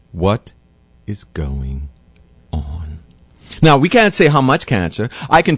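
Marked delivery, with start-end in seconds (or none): 0.1-3.0 hushed disbelief. Real, not acted. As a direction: drop low and soft, almost a whisper. Dramatic rhetorical question, dropping voice.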